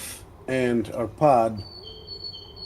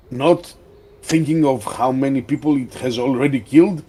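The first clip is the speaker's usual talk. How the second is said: raised, forceful in-character protest; indignant disbelief; 3 dB louder than usual. Acted. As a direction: insist on it, incredulous, louder and emphatic. GM voices Klopp challenging modesty.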